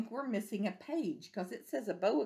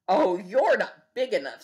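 louder scornful brush-off; grouchy bug excusing itself; grouchy dismissal; 8 dB louder than usual. Acted. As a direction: back down with a sneer. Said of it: "grouchy ladybug backs off".

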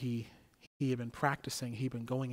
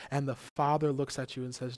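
ordinary talk, nothing marked

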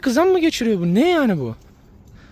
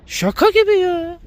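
high incredulous drawl; 10 semitones higher, slower than usual. Real, not acted. Disbelief at dogs.

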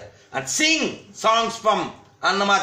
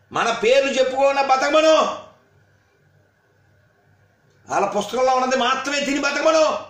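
higher, louder heated rant; 8 semitones higher, 4 dB louder than usual. Real, not acted. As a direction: drive each question home with rising anger. Angry rant at a commenter.

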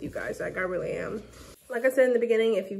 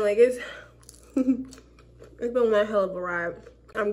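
chewing food; slower than usual. Real, not acted. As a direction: mouth partly full. Seen 1: Holds snack at 0.1, then covers mouth while speaking.

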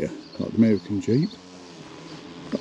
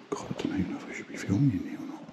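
quick unvoiced whisper, much softer; fast whisper; 7 dB quieter, faster than usual. Real, not acted. Unsure about filming inside the church, quick whisper. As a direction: rush it out under your breath. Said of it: "Why am I whispering?".

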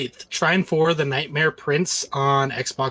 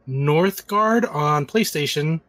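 ordinary talk, nothing marked

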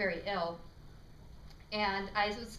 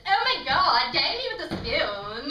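exaggerated LA accent, high and loud; drawn-out, swooping LA accent; 6 semitones higher, 10 dB louder, slower than usual. Shown as a demonstration. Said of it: "L A accent class".

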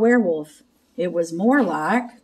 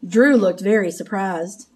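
ordinary delivery

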